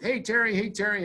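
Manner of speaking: high, loud call across a room; yelling from the crowd. Retold or imitated. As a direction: shout for Terry over the crowd. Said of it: "people are yelling up".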